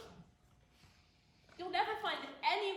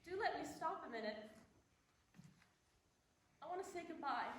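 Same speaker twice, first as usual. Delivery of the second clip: soft tearful plea; 2 semitones lower, 7 dB quieter, faster than usual. Acted. Heroine pleads softly to stop, wants to cry.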